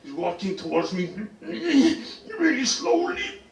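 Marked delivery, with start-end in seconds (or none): 1.4-3.4 drawn-out high slow-motion words; comically elongated, pitched up. Acted. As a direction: stretch it out high, very slowly. Acting the slow walk.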